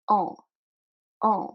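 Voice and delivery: "En" as an open nasal vowel. Shown as a demonstration. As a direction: say "En" through the nose. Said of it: "Might take a little bit".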